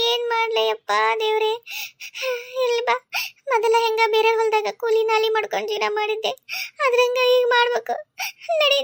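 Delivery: high, crying wail, voice breaking on the pleas; wailing lament. Acted. Cast out of the house, she leaves with her mother, crying and wailing. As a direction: sob it out as if all is lost. Thrown-out woman wailing, leaving.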